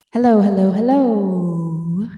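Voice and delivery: echoing as if in a cave; reverb. Shown as a demonstration. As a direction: cave echo. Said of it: "This is what the reverb sounds like".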